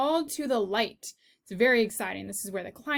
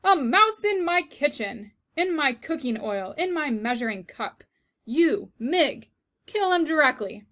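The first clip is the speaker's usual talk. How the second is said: loud, higher outraged cry; outraged cook; 5 semitones higher, 6 dB louder than usual. Acted. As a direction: rant in horror, then order the kill. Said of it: "said Cook".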